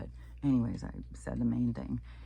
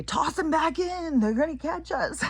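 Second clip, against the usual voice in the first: much higher, louder urgent voice; panicked guide; 6 dB louder than usual. Retold or imitated. Imagined tour guide urging them to throw the seal back. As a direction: hurry the seal off the boat. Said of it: "He'd say".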